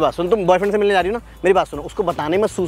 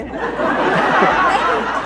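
high breathy burst of laughter; 11 semitones higher than usual. Real, not acted. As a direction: laugh at the prank. Laughs after prank. (ज़ोर की हँसी)

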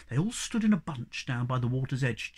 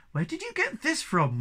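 surprised; 13 semitones higher than usual. Acted. Moomin Papa pleased surprise.

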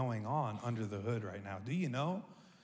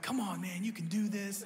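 encouraging dad; 5 semitones higher than usual. Retold or imitated. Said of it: "you know I'm an encourager".

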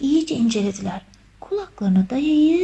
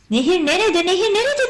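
high, raised cry of alarm; alarmed shouting; 7 semitones higher, 5 dB louder than usual. A narrator's reading. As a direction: call out in rising panic. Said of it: "diye bağırdılar".

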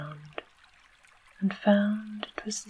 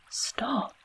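whispered scream; 6 semitones higher than usual. A narrator's reading. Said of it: "screamed the girl".

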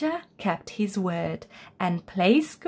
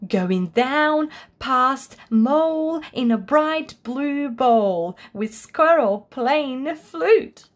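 usual speaking voice

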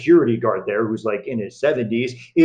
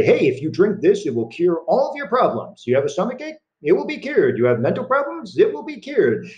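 a hint of a snake-oil salesman, rising ever higher; salesman's pitch; 6 semitones higher than usual. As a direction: hawk it like a travelling huckster, climbing in pitch. Voicing traveling salesman's sales pitch.

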